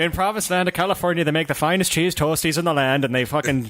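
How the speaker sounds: hammed-up Irish brogue; Irish accent. Comedian spinning a tale of California toasties, mock Irish accent. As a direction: tell it like an old Irish tale. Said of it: "Joe does a better Irish accent".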